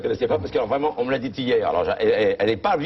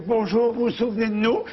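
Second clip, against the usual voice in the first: put-on old man greeting; 9 semitones higher than usual. Husband greeting hotelier.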